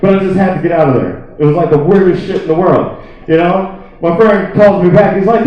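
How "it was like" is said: ordinary talk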